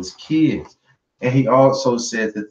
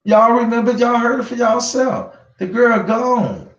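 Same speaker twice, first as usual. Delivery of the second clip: real excitement, pitched way up; worked up; 10 semitones higher than usual. Real, not acted. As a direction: hit it up high like the reveal just landed. Emphatic point to the audience.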